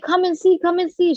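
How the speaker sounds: retold urgent call, high and loud; excited calling out. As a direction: call Mom over from the other room. Retells calling mom from another room.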